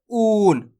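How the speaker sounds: "ऊन" with the oo drawn out slowly; stretched long oo. Shown as a demonstration. Teacher gives an example word, "ऊन" stretched long. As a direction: hold the vowel of "ऊन" before the n. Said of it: "उसको खींच के पढ़ेंगे".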